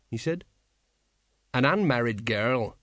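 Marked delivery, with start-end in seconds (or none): none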